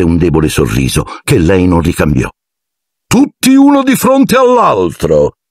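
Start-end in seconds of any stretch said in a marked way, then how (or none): 3.1-5.4 high, slow showman's shout; shouted command. A narrator's reading. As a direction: call the class to order like a showman. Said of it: "gridò Lockhart". (grido alto e teatrale)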